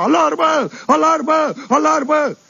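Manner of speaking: a dazed sergeant yelling the alarm over and over; howling alarm. Acted. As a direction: sergeant running through the dorm yelling the alarm.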